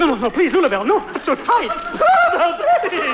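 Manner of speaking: strained pleading while crushed in a hug; gasping under a bear hug; squeezed breathless. Acted. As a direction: voice pinched and rising.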